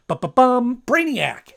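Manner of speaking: loud, high sung-out fanfare; mock dramatic reveal. Host revealing the episode's villain, mock dramatic fanfare. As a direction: punch out a playful drumroll and villain name.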